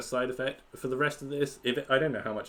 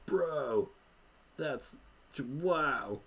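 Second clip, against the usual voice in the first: high, stunned bro voice; amazed bro; 8 semitones higher, slower than usual. Acted. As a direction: wow like a hyped bro. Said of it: "Gork's like".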